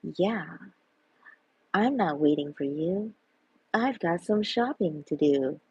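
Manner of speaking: normal talking voice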